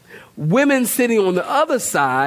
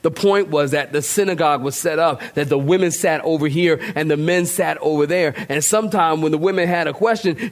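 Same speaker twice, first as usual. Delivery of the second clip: ordinary talk, nothing marked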